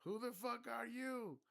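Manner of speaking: very high, loud, slow yelled question; mock yell. Other guy yelling back across ships, high loud yell. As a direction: holler it across the dock. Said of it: "the guy goes".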